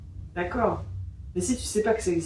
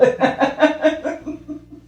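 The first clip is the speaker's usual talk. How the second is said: amused laugh; 6 semitones higher than usual. Real, not acted. Laughs at a cheeky admission.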